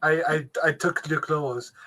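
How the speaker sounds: slow, hammed-up Greek accent imitating Russell Crowe; Russell Crowe's Greek accent. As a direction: drag out the words in a mock Greek accent. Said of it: "I'll do his Greek accent for you".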